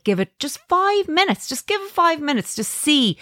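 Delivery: high, pleading encouragement; coaxing. Retold or imitated. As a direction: coax a reluctant child. Coaxing her son to watch.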